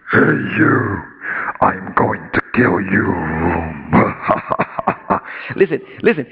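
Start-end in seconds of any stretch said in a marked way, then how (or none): 0.1-3.1 low, slow movie-demon threat; hammed-up demonic villain, deep and slow; mock Satan voice. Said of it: "because you're expecting this". 3.2-5.5 low, drawn-out villain cackle; deep demonic belly laugh, played for show; mock evil laugh. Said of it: "if Satan talked that way".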